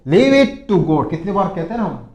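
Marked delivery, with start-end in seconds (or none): 0.1-1.1 very slow and loud; word by word; slow teacher demo; slow model phrase. Shown as a demonstration. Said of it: "फिर देखो".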